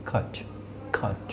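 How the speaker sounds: খাট with a short open throat আ; short throat vowel. Shown as a demonstration. Phonetics teacher modelling the short vowel. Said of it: "এই শব্দগুলো যদি উচ্চারণ করেন কীরকম হয়".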